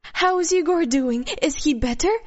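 urgent, hopeful questioning; eager questioning. Acted. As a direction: press for the news with excited concern. Eager question about sick child.

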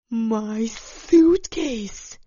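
drawn-out loud wail; stretched, loud cry of dismay. A narrator's reading. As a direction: wail it slowly in distress. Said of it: "she wailed".

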